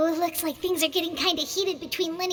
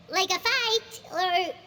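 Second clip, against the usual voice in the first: ordinary delivery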